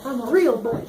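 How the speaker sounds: high-pitched little puppet voice; Pinocchio. Acted. Mud people coming to life, high puppet-boy voice. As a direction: lift it into a toy boy's voice. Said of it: "came to life like Pinocchio".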